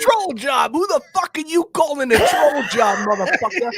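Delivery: loud, high mock-indignant shout; hammed-up shouted challenge; mock outrage. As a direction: fire back as if insulted, playing it up.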